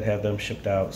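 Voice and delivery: ordinary delivery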